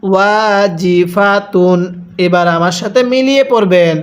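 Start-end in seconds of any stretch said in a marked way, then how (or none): 0.0-1.8 measured syllables with held vowels; deliberate teaching recitation; model recitation. Shown as a demonstration. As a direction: show the reading, syllable by syllable. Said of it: "কিভাবে পড়তে হবে".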